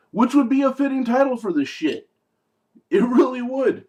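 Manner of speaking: gleeful loud jab at the movie; amused outburst. Real, not acted. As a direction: belt it out amused at the pun. Joking about the title.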